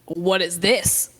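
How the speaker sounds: slow, mocking copy of the monk; mock echo. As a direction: parrot his words back slowly to tease him. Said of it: "Pandora looks back and says".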